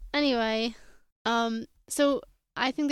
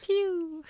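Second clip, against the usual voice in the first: high playful "pew" sound effect; ping noise; 8 semitones higher, 5 dB louder than usual. Retold or imitated. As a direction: zap it out like a toy laser. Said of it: "making ping, ping noises".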